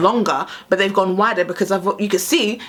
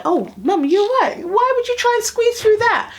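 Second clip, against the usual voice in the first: put-on higher, concerned teenage son; imitating son; 14 semitones higher than usual. Son asking mum why she squeezed past, mock concern. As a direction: voice the son's worried disbelief. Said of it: "and he'd be like".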